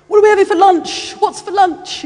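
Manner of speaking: high, eager mock questioning; lunch-obsessed disciples. Hungry disciples pester Jesus about lunch, high and insistent.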